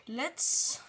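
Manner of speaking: slow, deliberate put-on English accent; hammed-up English accent as a joke; clumsy English accent, drawn out; bad English accent. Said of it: "Mon accent anglais est déplorable".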